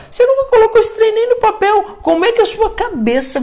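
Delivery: a high, incredulous cry at the listener; exasperated outburst. Real, not acted. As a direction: throw it at them in disbelief. Climactic scolding of audience.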